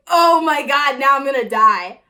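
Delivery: half-laughing mock terror; mock panic. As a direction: wail it like doom is coming. Panic at dad's discovery.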